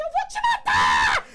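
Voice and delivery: drawn-out, high, full-volume retold scream; screaming threat. Girlfriend threatening boyfriend while police pass, hysterical screaming. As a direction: scream it slowly, completely hysterical. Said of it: "Eu gritava".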